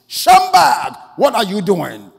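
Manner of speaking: high, booming shout to wake a sleeper; rousing shout. Retold or imitated. Angel shaking the sleeping preacher awake, rousing shout. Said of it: "An angel woke him up, shook him violently".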